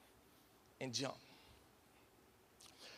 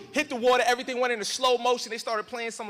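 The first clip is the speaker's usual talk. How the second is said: ordinary delivery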